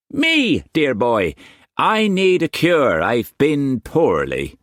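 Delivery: high, coaxing flatterer; butter him up, pitched high; wheedling Quantz. A narrator's reading. Quantz pleading poorliness.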